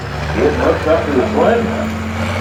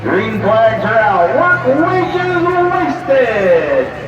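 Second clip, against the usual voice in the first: tractor pull announcer; 6 semitones higher, much slower than usual. Real, not acted. Calls the pull start.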